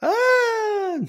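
high mock scream at the secret word; Pee-wee secret word scream. Reacting to the podcast's secret word, high mock scream. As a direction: shriek like a kids' show audience. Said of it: "I'm doing Pee-wee's Playhouse".

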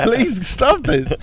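high, slow mock plea; play-acted high-pitched pleading. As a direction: beg in hammed-up desperation, drawn out.

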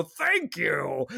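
put-on frail, creaky old voice; very old man. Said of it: "I was waiting for him to go".

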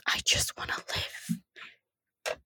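slow unvoiced whisper, barely audible; whispered plea. [name] to the group, whispering slowly that he just wants to live. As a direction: breathe it out like a cornered man begging. [name] cornered, pleading quietly.

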